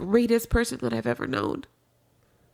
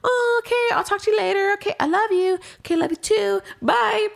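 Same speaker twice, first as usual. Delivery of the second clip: mock lingering goodbye; 11 semitones higher than usual. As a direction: put on a sugary, long-winded phone goodbye. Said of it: "there's no, you know, like".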